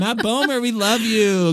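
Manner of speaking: high-pitched fan appeal; playful calling out. Pretend. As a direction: call out to an absent star. Mock appeal to absent actor.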